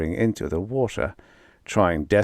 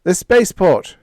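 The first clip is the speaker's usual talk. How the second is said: loud, higher shout; 10 semitones higher, 8 dB louder than usual. Acted. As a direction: call it out. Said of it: "shouted Brunet".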